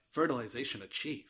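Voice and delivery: low, soft, lifeless line reading; flat wooden delivery. Retold or imitated. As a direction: say it as badly and flatly as the actress. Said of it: "I think she was like".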